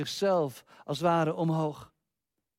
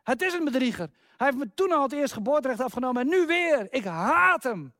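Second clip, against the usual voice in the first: Esau shouting furiously; 6 semitones higher than usual. Retold or imitated. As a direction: yell in betrayed rage at the brother. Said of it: "schreeuwt Esau uit de huid".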